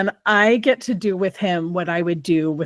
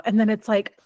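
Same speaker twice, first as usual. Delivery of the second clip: normal talking voice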